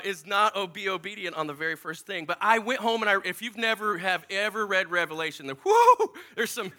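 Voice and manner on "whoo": high, loud whoop; amazed "whoo". Acted. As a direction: whoop at how wild it is. Reacting to Revelation's strangeness.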